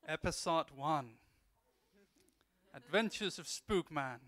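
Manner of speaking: booming slow cartoon title read; dramatic title announcement. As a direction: announce the episode like a trailer. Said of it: "I'm gonna dub it for you".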